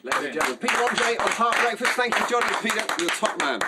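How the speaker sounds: loud, booming radio host introduction; radio announcer. Acted. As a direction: sell the guest to listeners like a breakfast show host. Host signs off the guest.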